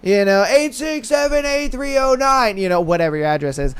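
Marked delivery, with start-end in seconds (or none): 0.5-2.6 half-hearted yell on a higher pitch; mock yelling. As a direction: mimic yelling an address over a crowd. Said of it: "you're yelling out your address".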